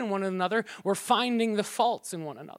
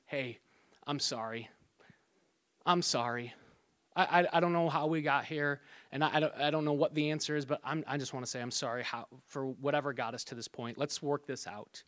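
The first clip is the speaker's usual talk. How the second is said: soft, low, conciliatory apology; gentle apology; 5 semitones lower, 7 dB quieter than usual. Acted. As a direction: make peace quietly, voice lowered. Acted apology to wife in argument.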